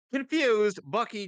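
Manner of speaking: high, loud burst on one word; dramatic beat. Acted. Narrating Bucky's escape, sudden high dramatic burst. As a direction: punch it up high.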